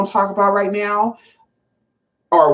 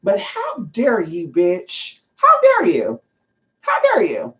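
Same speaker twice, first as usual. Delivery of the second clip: real pitched-up outrage; outraged; slower than usual. Real, not acted. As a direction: scold her in disbelief. Outraged rant at rapper.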